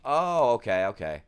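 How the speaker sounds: loud, dismissive oh, then dropping off; wife's mock "oh okay". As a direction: imitate the wife brushing it off. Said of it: "she goes".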